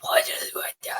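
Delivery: whispered disbelief, barely any voice; hushed, baffled whisper; stunned whisper. Retold or imitated. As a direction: whisper it, trailing into near silence. Retelling own stunned reaction.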